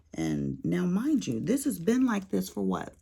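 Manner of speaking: ordinary delivery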